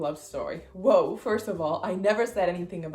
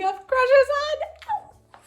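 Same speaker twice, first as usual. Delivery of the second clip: gushing; 10 dB louder, much slower than usual. Real, not acted. Gushing over celebrity crushes.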